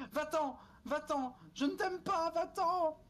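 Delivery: high, desperate shouts of go away; terrified pleading. Real, not acted. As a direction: beg the monster to leave, panicking. Chased by a monster.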